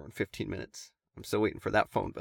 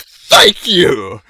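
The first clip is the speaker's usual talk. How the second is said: very loud, high imitated yell; exasperated shout; 6 semitones higher, 19 dB louder than usual. Ranting guy answers the one agreeing, mock shout. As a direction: blast out the thanks as the fed-up guy. Guy's shouted reply in retold quarrel.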